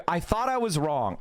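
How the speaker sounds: ordinary talk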